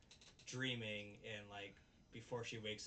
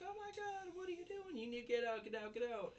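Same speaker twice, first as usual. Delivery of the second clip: much higher, shrieking get out; mock scream; 13 semitones higher, 3 dB louder than usual. Girl in a towel shrieking at the man in her room. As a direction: shriek at the intruder in a falsetto. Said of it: "she starts screaming".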